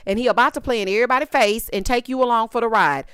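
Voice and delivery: normal talking voice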